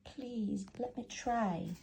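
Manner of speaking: soft, hoarse croak of a lost voice; croaked whisper. Acted. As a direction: barely get the words out. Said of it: "Cindy croaked out a whisper".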